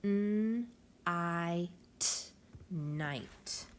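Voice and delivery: teacher sounding out each phoneme, then blending; segmenting sounds. Shown as a demonstration. Teacher models segmenting for the class, sound by sound. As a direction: stretch the word sound by sound. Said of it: "Finger stretch with me".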